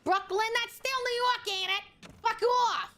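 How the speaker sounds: put-on high-pitched, irritable New York accent; mock-angry Brooklyn accent. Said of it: "It's the fucking accent".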